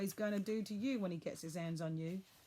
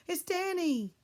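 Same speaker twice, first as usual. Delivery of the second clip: loud, much higher cry; shouting; 11 semitones higher, 7 dB louder, slower than usual. A narrator's reading. Boy telling the dark wood who he is, shouting. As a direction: yell his own name. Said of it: "I shouted".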